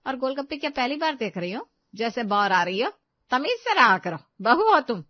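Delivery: loud, stern telling-off; scolding mother-in-law. Acted. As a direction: scold your daughter-in-law, raising your voice. Mother-in-law scolding her daughter-in-law.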